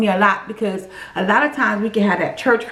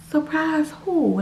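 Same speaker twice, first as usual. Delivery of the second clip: confused surprise; 5 semitones higher, 4 dB quieter, slower than usual. Retold or imitated. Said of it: "I'm, like, shocked".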